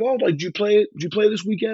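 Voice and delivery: high bright eager questioning. Retold or imitated. Rock star asking the young band, high eager question. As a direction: pitch it up like a starstruck fan. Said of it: "he was like".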